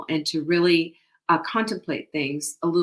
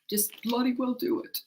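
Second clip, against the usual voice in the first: put-on higher, softer English accent; lightly put-on English accent, pitched up; father's English accent; 6 semitones higher, 5 dB quieter than usual. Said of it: "in his English accent".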